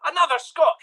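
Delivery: another scot squeaked up high, outraged; mock outraged squeak. Repeats in exasperation.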